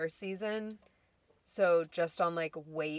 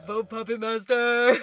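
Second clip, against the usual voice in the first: loud, raised-pitch rallying cry; campaign shout; 4 semitones higher, 11 dB louder than usual. Real, not acted. Urging co-hosts to vote for an episode, loud campaign shout.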